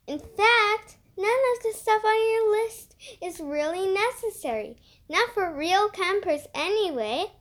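put-on know-it-all girl Willa. A narrator's reading. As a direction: lecture the others like the expert.

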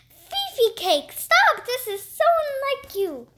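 high, loud cry on stop; a shocked owner crying out, stop jumping high; cried out. Acted. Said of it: "Mister Pennyworth cried".

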